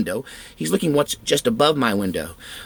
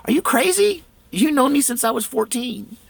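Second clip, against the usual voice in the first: wife's incredulous retort; 12 semitones higher than usual. Retold or imitated. Wife scoffs at him.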